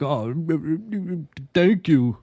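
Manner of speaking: mock tongue-tied mumbling before the thanks; awkward stammering. Cohost imitating a flustered man thanking her, awkward stammer. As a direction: stumble over sounds, then get the thanks out. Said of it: "most awkward fucking stammering".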